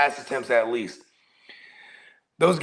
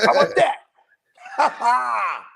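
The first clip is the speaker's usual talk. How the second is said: high, loud mocking laugh; laughing gloat; 11 semitones higher, 5 dB louder than usual. Real, not acted. Gloating joke at Titans fan.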